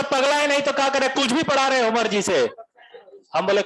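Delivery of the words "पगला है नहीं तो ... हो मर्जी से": higher, irritated Bihari complaint; Bihari student voice. Retold or imitated. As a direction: play the fed-up Bihari boy. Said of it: "Bihari". (Bihari लहजे में चिढ़कर)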